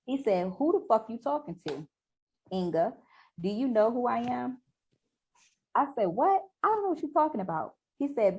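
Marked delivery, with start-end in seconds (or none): 0.6-4.6 raised-pitch acted threat; confrontational challenge. Acting out his threatening retort. 6.1-7.8 high-pitched, exasperated comeback; indignant denial. Acted. Acting out her heated denial.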